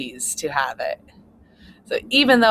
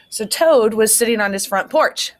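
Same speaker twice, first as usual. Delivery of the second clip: put-on reading to children on the porch; clear, expressive read-aloud for kids; story narration; 3 semitones higher, 5 dB louder than usual. A narrator's reading. Teacher reads story aloud.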